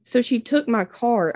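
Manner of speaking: ordinary delivery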